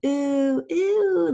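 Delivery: "ooh" drawn out, rising high and loud; ooh sound demo. Shown as a demonstration. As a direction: model the "ooh" vowel big for the class. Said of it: "like this".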